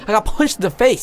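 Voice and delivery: high-pitched protest; indignant complaint. Retold or imitated. Punched man complains to the apologizing girl, indignant.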